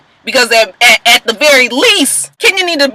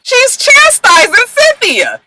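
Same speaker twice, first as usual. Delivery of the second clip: slow, much higher pitch; indignant; 11 semitones higher, slower than usual. Real, not acted. Vlogger recaps reality show, indignant high. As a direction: stretch it out high in outrage.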